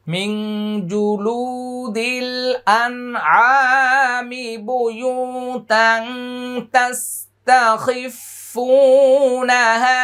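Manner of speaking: slow, high melodic tilawat; Quran recitation. Shown as a demonstration. Teacher chanting the verse for students, slow and high. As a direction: chant each word long and high.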